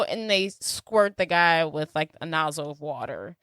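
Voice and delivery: ordinary delivery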